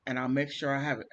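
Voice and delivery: usual speaking voice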